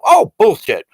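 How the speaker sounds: loud, high outburst of disgust; scornful dismissal. Acted. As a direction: shoot the claim down scornfully. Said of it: "that's how I feel about it".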